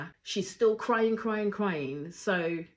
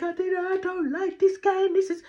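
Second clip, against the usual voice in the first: high, loud mock-crying complaint; squealed mock protest; mocking whiner; 13 semitones higher, 7 dB louder than usual. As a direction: imitate a tearful complainer, pitched way up. Mimics crying contestant complaining.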